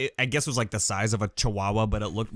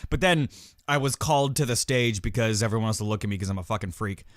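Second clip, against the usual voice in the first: ordinary talk, nothing marked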